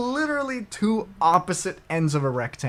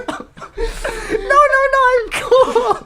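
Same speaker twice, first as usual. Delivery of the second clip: much higher, louder laughing squeal; squeaky nerd fan; 9 dB louder, much slower than usual. Pretend. Friend plays the fan brushing off the neighbor, laughing squeal. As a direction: squeak out the denial through laughter.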